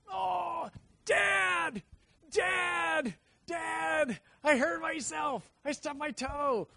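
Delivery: put-on high, loud wailing kid calling dad; child crying for dad. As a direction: wail like a hurt child running for help.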